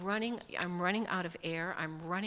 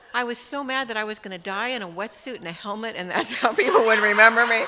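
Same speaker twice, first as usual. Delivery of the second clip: laughing through the words, louder; real laughter breaking in, rising louder; 3 semitones higher, 12 dB louder than usual. Real, not acted. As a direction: chuckle at your own foolish thought. Laughs at her own story.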